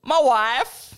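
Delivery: Southern accent slipping into Borat; bad Southern accent. Shown as a demonstration. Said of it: "Now you sound like Borat".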